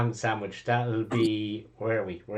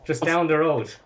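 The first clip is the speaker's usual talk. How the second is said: excited barman pitch; 6 semitones higher, 8 dB louder than usual. Acted.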